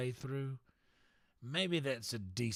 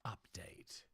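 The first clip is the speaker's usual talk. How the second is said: whisper; 3 semitones lower, 16 dB quieter than usual. Pretend. Whispered one-word aside.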